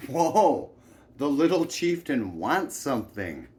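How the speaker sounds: loud, high mocking whoa; booming fake-awe; mock exclamation. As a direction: tease a spoiled child loudly. Men mock the spoiled girl.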